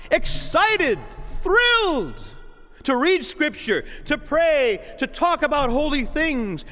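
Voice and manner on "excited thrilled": rising excited lift. Acted. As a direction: pump up the words with thrill. Preacher acts out passion.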